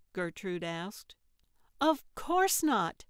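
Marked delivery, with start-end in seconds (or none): 1.8-3.1 slow, high, loud protest; cried out. Acted. Trixie protesting she won't kill Frank, loud high cry. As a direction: cry it out, offended. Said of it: "Trixie cried".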